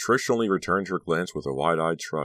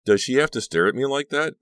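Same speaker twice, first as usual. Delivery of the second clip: higher, louder uneasy question; uncomfortable; 9 semitones higher, 6 dB louder than usual. A narrator's reading. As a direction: bristle under the stare. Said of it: "Becca thought uncomfortably".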